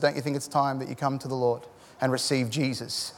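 soft, low pastoral appeal; gentle invitation. Retold or imitated. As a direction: invite him quietly and tenderly. Gentle invitation to crying man.

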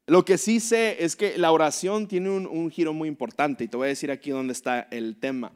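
normal talking voice

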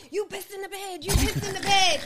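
high, rising to a loud yell; mock accusing yell. Boyfriend yelling at girlfriend he thinks wet the bed, mock accusation. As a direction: belt out the accusation at her. S2 voices him accusing her.

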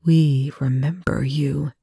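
slow, cold alien whisper through Chloe; eerie alien voice whispering through a possessed woman. Acted. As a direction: breathe it out slowly and coldly. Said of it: "Chloe's voice was slow and cold".

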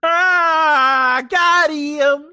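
high, loud gotcha cry; triumphant shout. Real, not acted. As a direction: crow it out loud. Triumphant jab at friend.

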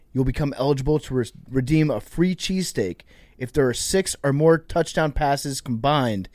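brisk sponsor read to listeners. Acted. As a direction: sell the promo clearly. Host reads sponsor ad.

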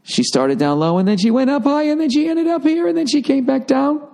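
mock sing-song sliding way up; robotic inflection arc. Shown as a demonstration. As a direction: imitate a programmed clerk's rising pitch. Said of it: "inflection arc".

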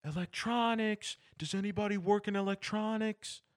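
hammed-up high-pitched customer yell; mock yelling customer. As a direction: holler for help down the aisles. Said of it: "people will yell".

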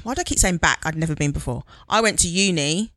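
ordinary delivery

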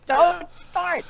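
playful warning. Real, not acted. Teasing a listener.

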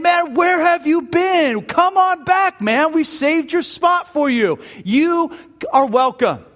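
high-pitched, eager buddy act; excited welcome. Acted. Old buddies with open arms tempting him back, excited welcome. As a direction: call out like old pals beckoning him back.

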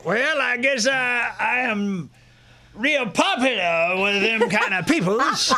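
high, slow mock Arizona drawl. Host bragging about police fans, mock Arizona accent. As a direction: borrow the Arizona cop's twang.

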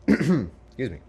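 ordinary talk, nothing marked

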